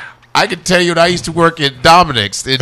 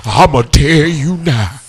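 put-on churchy old lady; 3 dB quieter, much slower than usual. Acting churchy elder woman.

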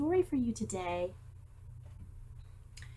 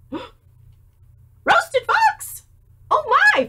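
much higher and louder mock fright; scared fox; 10 dB louder, much slower than usual.

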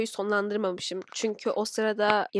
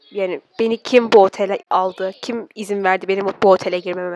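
mock outrage; 11 dB louder than usual.

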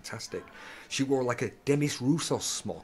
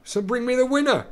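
high, loud punchline delivery; indignant demand; 13 semitones higher, 9 dB louder than usual. Acted. Diner ordering waiter about, comically demanding. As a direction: fire it back high and loud.

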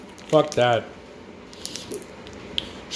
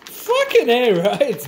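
slow, higher cheer; drawn-out cheer; 9 semitones higher, 3 dB louder, slower than usual. Real, not acted. Cheering the boys on, slow and high. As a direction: draw out the cheer.

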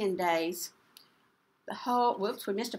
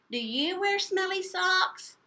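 plain reading voice

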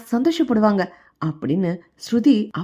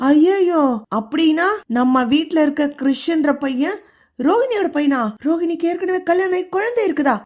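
high-pitched, aghast disbelief; shocked; 8 semitones higher than usual. A narrator's reading. Shruti overhearing Rohini's secret child, shocked and high. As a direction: gasp it out in alarmed astonishment, pitched high. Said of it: "ரொம்ப அதிர்ச்சியோட".

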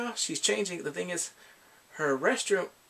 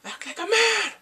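high-pitched exasperated demand; hammed-up frustrated order; mock scolding; 4 dB louder than usual. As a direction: urge him high, fed up with his dithering. Acts out scolding the hero.

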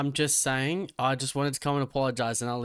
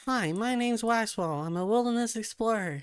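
Maxwell; 8 semitones higher than usual. Pretend. Wilderness explorer introducing himself, mocking nerd voice. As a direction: introduce yourself as a lisping nerd scout. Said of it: "It's the Maxwell accent".

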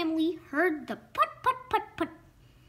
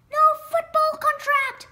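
high, loud lament; crying out; 10 semitones higher, 6 dB louder than usual. A narrator's reading. Father turkey loses the football deal, loud high cry. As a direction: wail it like a dismayed father. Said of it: "cried Father".

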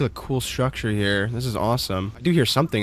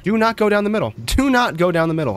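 higher-pitched, emphatic command said twice; urgent warning; 9 semitones higher, 4 dB louder, faster than usual. Real, not acted. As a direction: warn them firmly, repeating every word. Urgent repeated warning in the game.

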